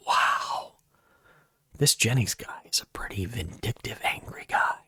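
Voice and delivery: hushed whisper; mock whisper. Staff in the silent office reacting to his outburst, whispering. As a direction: whisper it like a shocked onlooker.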